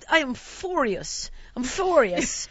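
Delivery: slow, mildly imitated indignation; a hint of an indignant elderly lady; lightly voiced Italian lady. Elderly Italian passenger protesting the stalling, lightly imitated indignation. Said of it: "the Italian lady starts, she's like".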